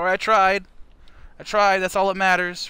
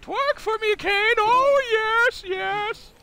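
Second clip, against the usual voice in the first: a much higher, drawn-out lecherous boss voice; Vince McMahon impression; slower than usual. Streamer reading a donation as the wrestling boss, in a much higher put-on voice. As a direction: ham up a leering wrestling boss. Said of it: "the next donation will get a Vince McMahon".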